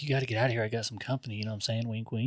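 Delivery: hushed nudge-nudge imitation of a guy; conspiratorial aside. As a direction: drop the volume for a sly hint. Acted sly request for privacy, wink.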